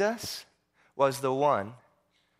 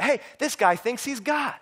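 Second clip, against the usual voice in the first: high-pitched scandalized exclamation; indignant, pitched well up; outraged accusation; 9 semitones higher than usual. Acted. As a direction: accuse him in acted disbelief. Outraged leaders' reaction acted out.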